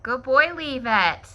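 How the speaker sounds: high, loud praise voice; dog praise. Real, not acted. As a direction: sing it up to the dog.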